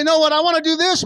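high, loud mock refusal; stubborn insistence, hammed up; mock stubborn protest. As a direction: push back on God like a child. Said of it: "And you say".

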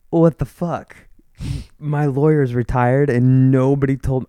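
mock-indignant protest; retold outrage. Client calling the bank about a retired lawyer, retold outrage.

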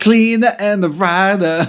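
high sneering singsong; mocking. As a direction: sneer at the plot. Mocking the storyline.

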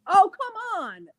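drawn-out, high incredulous protest. Real, not acted. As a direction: stretch it out in disbelief. Incredulous reaction to no weed.

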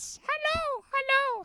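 squeaky falsetto character answering from downstairs; very high, silly put-on voice; Pope Benedict. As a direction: call back in a comic falsetto. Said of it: "do you wanna get Pope Benedict".

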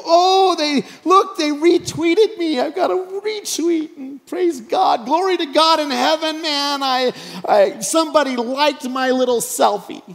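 mock ecstatic shouting praise; giddy excitement. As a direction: gush over a retweet like it's a miracle. Mock giddy reaction to a retweet.

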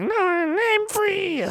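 drawn-out squeaky high cry; Roulette voice. Retold or imitated. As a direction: stretch it slowly in a tiny shrill voice.

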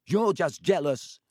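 put-on small boy piping up against Malfoy; piped up. A narrator's reading. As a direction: pipe up boldly for Harry. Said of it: "piped up Colin".

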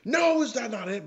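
high, mock-puzzled imitation. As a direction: mimic him in a high squeaky voice. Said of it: "I like how you just said".

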